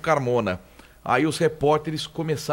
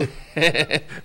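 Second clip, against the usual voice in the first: short burst of laughter; laughing; 3 semitones higher than usual. Real, not acted. Panelist laughs at own cemetery vase joke, laughing. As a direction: break into a quick laugh.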